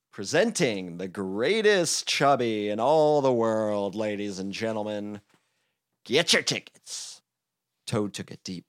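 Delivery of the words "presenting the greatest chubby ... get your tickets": announcer voice. Acted.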